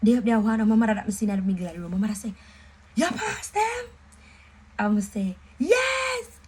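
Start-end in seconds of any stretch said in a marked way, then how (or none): 3.0-3.8 mother's question, far higher and eager; excited mother. Retold or imitated. Mother rushing out to ask about exam results, high and eager. 5.6-6.4 a much higher, louder triumphant yell; joyful shout. Retold or imitated. Answering her mother about passing exams, a high joyful shout.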